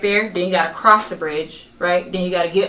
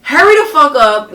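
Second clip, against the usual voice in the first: urging friend; 6 semitones higher, 7 dB louder than usual. Retold or imitated. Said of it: "telling Foodie".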